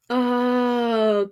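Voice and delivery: usual speaking voice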